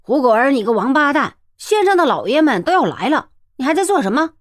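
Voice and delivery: loud angry scolding. Acted. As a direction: bark at him like a petty official. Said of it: "一个声音骂道". (破口大骂)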